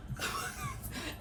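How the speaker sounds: a short airy laugh; soft breathy laugh. Real, not acted. Laughing softly while telling viewers about him. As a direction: let a quiet laugh slip out.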